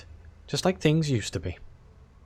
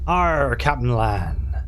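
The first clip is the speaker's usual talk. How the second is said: slow, drawn-out pirate Arr; hammed-up pirate voice; pirate growl; 3 dB louder, much slower than usual. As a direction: growl it like an old sea dog. Pirate crew member's reply.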